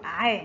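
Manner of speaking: ع sounded out as one open syllable; ع with fatha. Shown as a demonstration. Teacher showing learners ain with fatha, ع sounded out. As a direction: say ع as a throat consonant plus short a. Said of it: "حرف العين محرك بحركة الفتح ينطق".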